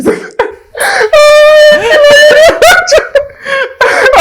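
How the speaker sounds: long loud real laugh that tips into crying; host cracks up at cohost's joke, loud helpless laughter; loud laughing fit. Real, not acted.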